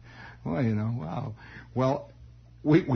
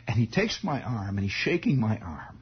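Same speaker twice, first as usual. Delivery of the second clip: ordinary delivery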